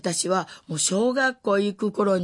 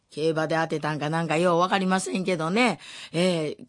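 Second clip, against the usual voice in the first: ordinary talk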